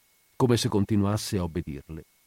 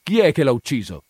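loud, higher-pitched interrogation; loud question; 5 semitones higher, 6 dB louder than usual. A narrator's reading. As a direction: demand the culprit as a police sergeant. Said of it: "chiese a voce alta". (domanda forte, da brigadiere)

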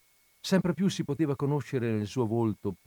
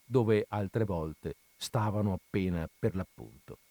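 ordinary delivery, nothing marked

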